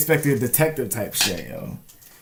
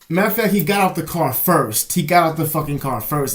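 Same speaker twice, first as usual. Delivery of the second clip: loud, raised correction of the story; emphatic correction; 6 semitones higher, 7 dB louder than usual. Real, not acted. Telling viewers the correction, loud and emphatic. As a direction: blurt out the fix, louder.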